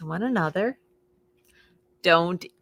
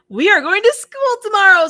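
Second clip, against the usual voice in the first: loud, high, bright call; excited announcement; 13 semitones higher, 12 dB louder, faster than usual. Acted. Farmer Brown announcing to Duck, loud and high. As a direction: announce it with gusto.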